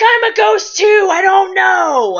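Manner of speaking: high, helpless shout; Gabe. Acted. Gabriel answering frantic Balthazar, shouting helplessly. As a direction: yell back in exasperation, stretching the end. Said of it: "And Gabe's like".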